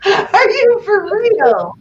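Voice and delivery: high, loud disbelief, drawn out at the end; incredulous. Retold or imitated. Girlfriend retelling her reply to her traumatized boyfriend, incredulous.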